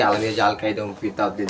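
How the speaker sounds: ordinary talk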